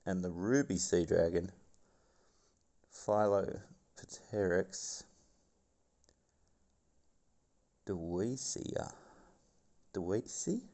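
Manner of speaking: documentary narrator voice; nature documentary. Acted. Reads documentary script.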